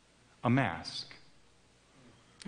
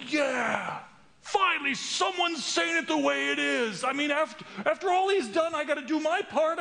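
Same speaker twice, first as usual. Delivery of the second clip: zealous excitement. Retold or imitated. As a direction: cheer like you've finally found the answer. Zealous inner voice cheering self-effort.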